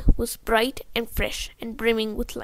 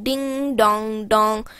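steamboat bell imitation; 4 dB louder, much slower than usual. A narrator's reading. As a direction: boom it out like a boy playing steamboat. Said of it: "personating a steamboat".

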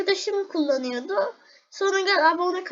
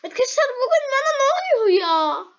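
higher, whining voice close to crying; whiny despair; 8 semitones higher, 4 dB louder, faster than usual. Real, not acted. Gamer to viewers when nothing works, whiny near-crying. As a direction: moan to the viewers in mock despair. Everything going wrong.

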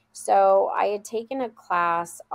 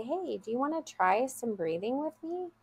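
soft, higher-pitched gentle invitation; very calm; 7 semitones higher, 5 dB quieter than usual. Retold or imitated. Teacher invites an overwhelmed child to breathe, very calm. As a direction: calmly coax an overwhelmed child. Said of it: "I would very calmly say".

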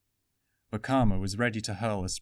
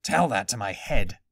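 louder, gruff grumble from a dazed Toa; Aniwa's voice; 7 dB louder than usual. A narrator's reading. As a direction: Aniwa waking up after being hit, grumbling.

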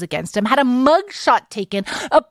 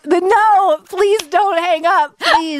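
high, louder playful begging; mock-desperate plea; 7 semitones higher, 3 dB louder, slower than usual. Host begging guest not to hang up, mock-desperate. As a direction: plead comically, pitched up.

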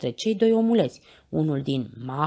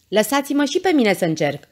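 raised, higher voice over a crowd; shouted offer; 5 semitones higher, 5 dB louder than usual. A narrator's reading. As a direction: call out urgently to be let through. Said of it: "strigă Pancinelă". (strigăt hotărât)

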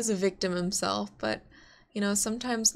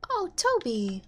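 pitying coo; 3 semitones lower than usual. Real, not acted. Pitying the dog in the game.

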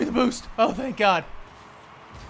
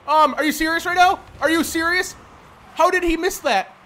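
loud, raised exasperated questioning; incredulous anger; 4 semitones higher, 5 dB louder than usual. Real, not acted. Player berating teammate over a miss, loud exasperation. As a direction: call it out in real disbelief.

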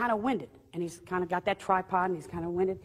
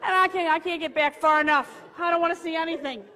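high, loud pleading wail; mock panic; 8 semitones higher, 5 dB louder than usual. Trying to get away from the apparition, mock-panicked. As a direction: protest in comic desperation, pitched way up.

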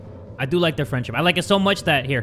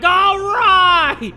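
high, loud, drawn-out holler; Adam Sandler; 13 semitones higher, 7 dB louder than usual. Acted. Player mocking own yelling, comic holler. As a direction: belt it out in comic outrage. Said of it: "I sound like Adam Sandler".

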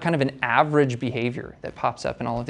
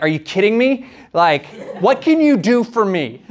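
mock exasperated caller; 7 semitones higher, 4 dB louder than usual. Annoyed Northeast caller on phone.

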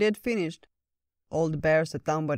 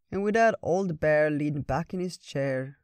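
usual speaking voice

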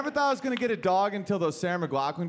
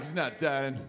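low determined vow; 6 semitones lower, 4 dB quieter, slower than usual. Retold or imitated. Determined rescuer.

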